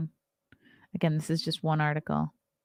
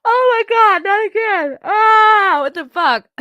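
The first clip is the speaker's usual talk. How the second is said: high, loud cry of frustration; exasperated outburst; 14 semitones higher, 11 dB louder, slower than usual. Real, not acted. Caught yawning again on camera, yelling in high-pitched exasperation. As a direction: shout it out high, fed up with yawning.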